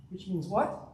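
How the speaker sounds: usual speaking voice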